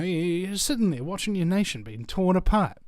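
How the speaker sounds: normal talking voice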